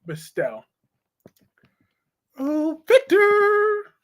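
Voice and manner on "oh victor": very high, very slow drawn-out call; mock wife calling. Shown as a demonstration. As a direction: stretch his name out like a doting wife. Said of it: "I used to say it a little differently".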